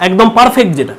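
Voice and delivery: ordinary talk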